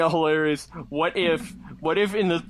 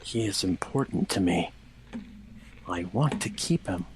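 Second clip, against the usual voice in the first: much lower, softer moody voice; lightly brooding Shadow; 12 semitones lower, 4 dB quieter than usual. Acted. Reading Shadow's thoughts in a low, brooding voice. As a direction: play the dark loner confessing.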